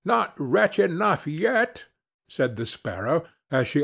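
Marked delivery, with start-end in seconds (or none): 0.1-1.9 high, slow taunt; sparrow's pitched-up, measured retort. Acted. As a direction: draw it out high, as a threat of more. Sparrow taunts the ruined carter.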